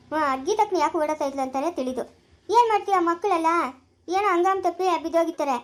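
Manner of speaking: ordinary delivery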